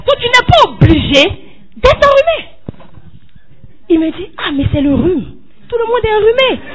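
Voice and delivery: fervent retold rebuke; preaching rebuke. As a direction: scold him as from the pulpit. Preacher retells her rebuke loudly.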